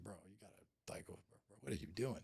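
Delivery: hushed, urgent aside from a lawyer; whispered lawyer. Retold or imitated. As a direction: whisper it like a lawyer leaning over mid-call. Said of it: "my lawyer's always just like".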